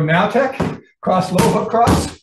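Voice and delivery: loud, punchy combination call; calling out moves. Shown as a demonstration. Instructor demonstrating the punch combination, calling it out loudly. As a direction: call each strike out firmly.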